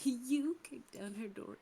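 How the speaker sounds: YOU stressed, slow and loud; correcting him, you stressed slowly. Real, not acted. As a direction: punch the word you. Retort correcting we.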